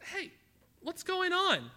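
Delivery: excited servant. Acted. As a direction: burst in with excitement. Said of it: "the servant just really excited".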